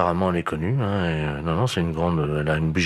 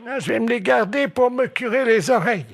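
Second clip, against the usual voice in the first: put-on high-pitched old lady; squeaky elderly woman on the phone; 3 dB louder than usual. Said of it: "passer le téléphone à la dame".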